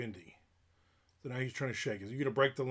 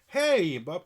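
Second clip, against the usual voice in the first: high, loud mock-cheery greeting; imitated caller; 5 semitones higher, 9 dB louder, much slower than usual. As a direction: mimic an over-eager doorstep greeting. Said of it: "she was like".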